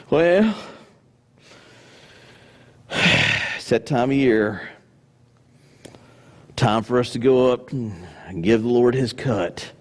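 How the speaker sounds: heavy sigh, then low, slow resignation; grudging and weary, dragged out low; put-on long-suffering drone; mock reluctant giver.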